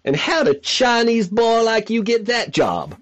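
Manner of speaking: put-on ten-year-old asks it in a deep South twang; deep South accent. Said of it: "sort of redneck accent".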